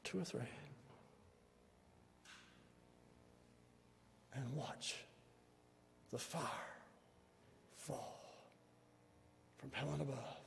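soft, spaced-out phrases with long pauses; hushed preaching appeal. Real, not acted. Preacher asking the congregation to watch for fire from heaven, hushed appeal. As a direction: let each phrase sink softly into silence.

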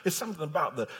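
ordinary talk

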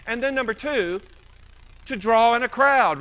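ordinary delivery

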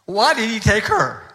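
a grieving widower's angry question; anguished outcry. Retold or imitated. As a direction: cry out why did he take her. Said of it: "He was just furious with God".

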